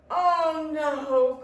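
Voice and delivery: loud, drawn-out wail; crying out. A narrator's reading. Tortoise shaken in the tree, crying out. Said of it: "cried Tortoise".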